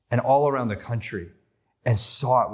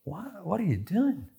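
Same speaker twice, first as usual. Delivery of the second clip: a light, higher imitation of a Scottish accent; mock a puzzled Scot in a Scottish accent; lightly imitated Scottish accent, a touch higher; 8 semitones higher, 5 dB quieter than usual. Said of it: "almost in that accent as well".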